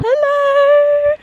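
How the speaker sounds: high, loud excited call; loud happy hello. Real, not acted. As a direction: call out a delighted greeting. Arriving, calling out greeting.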